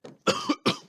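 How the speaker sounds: harsh hacking coughs, loud; loud coughing fit. Acted. Radio host to his listeners, a loud hacking cough. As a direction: cough hard, choking mid-broadcast. Oh God, penalties of live broadcasting.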